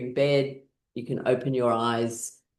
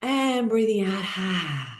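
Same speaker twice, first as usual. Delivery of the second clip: breathing out sigh; 5 semitones higher, slower than usual. Shown as a demonstration. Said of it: "big breath in".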